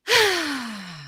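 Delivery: long open sigh of relief, falling away. Shown as a demonstration. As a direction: let the breath drop out in a relieved sigh. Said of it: "And again".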